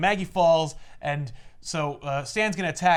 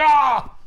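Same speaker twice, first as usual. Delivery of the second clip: short hammed-up yell, higher and louder; mock yell; 9 semitones higher, 9 dB louder than usual. Retelling the comic villain's attack, mock yell. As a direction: throw out a brief cartoonish cry.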